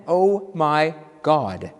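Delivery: very slow, word by word; deliberate repeat. Shown as a demonstration. Minister repeating it to a shocked congregation, slow and deliberate. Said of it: "I'll say it again".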